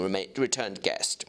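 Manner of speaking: usual speaking voice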